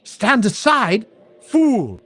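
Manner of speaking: high, shouted villainous rage; furious sorceress. Acted. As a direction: hurl it at him in fury. Sorceress's furious final outburst.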